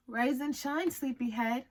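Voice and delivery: ordinary delivery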